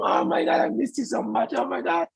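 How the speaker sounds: high, squeaky gushing. Retold or imitated. Hugging her boyfriend after missing him, high gushing. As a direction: gush in a high, thin voice. Retold tearful hug at the reunion.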